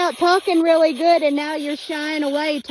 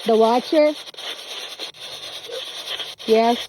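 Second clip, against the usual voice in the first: radio tuning in; 4 semitones lower, 4 dB quieter than usual. Real, not acted. Spirit box voices answer.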